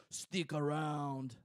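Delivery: slow, drawn-out Arnold one-liner; lightly voiced action-hero quip; bad Schwarzenegger impression. Host doing a weak Arnold Schwarzenegger for his co-host. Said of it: "That's, that's a terrible ... Schwarzenegger".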